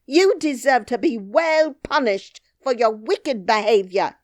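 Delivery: loud, high threatening scold; angry nurse. Acted. Nurse threatens the princess, loud.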